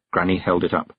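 ordinary delivery, nothing marked

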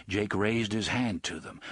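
ordinary delivery, nothing marked

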